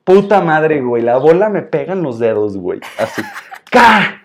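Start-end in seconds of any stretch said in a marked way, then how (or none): 3.7-4.2 sharp high yelp of pain, half laughing; loud laughing yelp; pained cry. Retold or imitated. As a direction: cry out as the ball smacks your fingers. Fingers hit by the ball.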